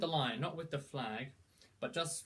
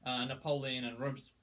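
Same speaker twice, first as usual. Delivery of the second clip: ordinary delivery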